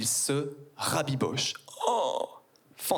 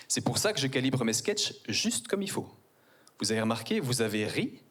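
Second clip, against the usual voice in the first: ordinary delivery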